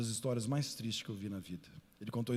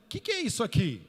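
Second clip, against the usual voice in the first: a stranger's loud question; 9 semitones higher, 8 dB louder than usual. Retold or imitated.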